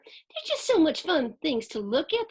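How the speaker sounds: ordinary delivery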